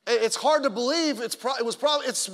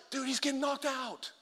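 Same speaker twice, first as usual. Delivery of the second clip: high-pitched, soft-volume imitation of excitement; passionate fan; 4 semitones higher, 6 dB quieter than usual. Guys rooting at a fight, imitated passionate fan. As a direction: mimic a hyped fight fan without yelling. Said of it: "there's passion".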